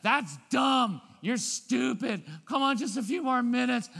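put-on loud, high whiny kid, dragged "You're stupid"; mock bratty shout; whiny kid protest. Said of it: "they're not gonna say that".

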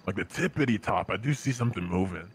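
deep voice. Acted. Spotting something far up the hill.

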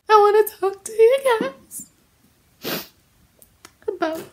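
high, put-on weepy voice; mock tearful apology. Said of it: "Let's put the tears on".

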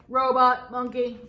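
ordinary delivery

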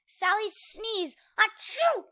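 sneeze. Acted. Sneeze in story.

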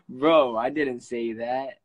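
real loud denial to his viewers; loud protest. Real, not acted. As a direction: protest loudly and slowly to the chat. Denying accusation while laughing.

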